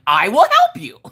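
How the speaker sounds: lightly hammed-up weird voice. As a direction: read it back in an odd, quavering voice. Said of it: "in this weird voice".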